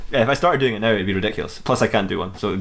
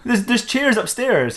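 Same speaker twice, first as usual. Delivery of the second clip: higher-pitched, mock-incredulous protest; 12 semitones higher, slower than usual. Dexter scolds himself about building a chair, incredulous. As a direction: scoff at a silly suggestion in a raised pitch. Dexter scoffs at his own idea.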